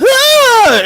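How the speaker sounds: high mock shriek; mock scream. Acting out Gale's scene, a high mock shriek.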